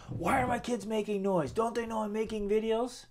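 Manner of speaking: hammed-up irritated father complaining about noise; annoyed dad. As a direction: huff about the noisy kids. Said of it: "So I could be sitting here like".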